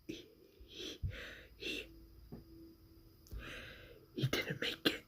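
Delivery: hushed, halting bad news; faltering whisper. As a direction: stumble through it in a whisper.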